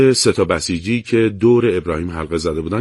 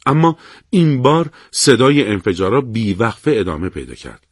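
usual speaking voice

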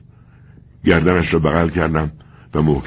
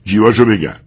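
barked order; 5 semitones higher, 7 dB louder than usual. Acted. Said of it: "گفت".

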